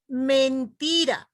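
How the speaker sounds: "mentira" with the TI stretched and stressed; teacher's drawn-out "men-TI-ra" demo; stress on second syllable. Shown as a demonstration. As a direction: slowly say "mentira", leaning on the middle syllable TI. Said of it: "elevación de voz que hacemos".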